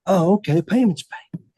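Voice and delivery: lightly acted bluffing nod; retold polite surprise. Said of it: "And I said".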